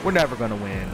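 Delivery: low, defeated resignation; dejected. Real, not acted. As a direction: give up, voice sinking low. Gives up on the race.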